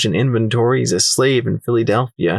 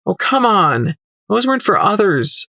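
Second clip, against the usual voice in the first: higher-pitched, indignant objection; embarrassed protest; 9 semitones higher than usual. Acted. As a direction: protest at being teased. Embarrassed protest at merchant's teasing.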